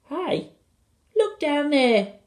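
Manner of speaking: put-on high-pitched little boy calling out; high child's call; Philip. A narrator's reading. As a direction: pipe up to get attention.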